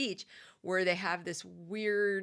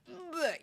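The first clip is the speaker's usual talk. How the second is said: disgusted bleh; 11 semitones higher than usual. Retold or imitated. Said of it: "and I was like".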